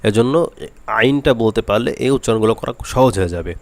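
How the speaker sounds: usual speaking voice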